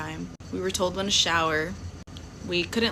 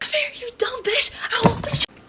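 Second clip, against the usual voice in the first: high, shrieking threat; hammed-up fury while restrained; mock furious screech; 4 dB louder than usual. Restrained girl shrieking threats at the narrator, hammed-up. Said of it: "really trying to get me".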